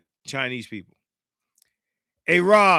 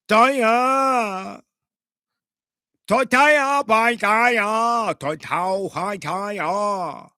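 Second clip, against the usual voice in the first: high, loud, drawn-out mock Chinese; exaggerated fake-Chinese gibberish with twang; 6 semitones higher, 3 dB louder, much slower than usual. Shown as a demonstration. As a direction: imitate Chinese with nonsense syllables dragged out. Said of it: "That's Chinese".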